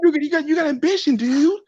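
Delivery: high, sing-song parroting; mocking coworker. Mimicking the coworker to his friends, a high mocking parrot. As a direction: throw his words back at him.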